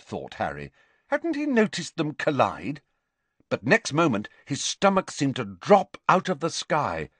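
plain reading voice

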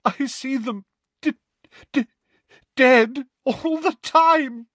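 high, stuttering, slow moaning through tears; Molly moaning into Lupin's shoulder; tearful moan. A narrator's reading. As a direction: moan it into his shoulder, choking. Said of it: "Missus Weasley moaned".

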